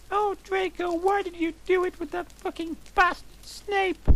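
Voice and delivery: very high falsetto, hammed-up tears; squeaky fake-tearful plea; mock tearful. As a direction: play a sobbing drama queen in falsetto. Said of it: "I asked tearfully".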